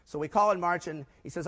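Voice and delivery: ordinary talk, nothing marked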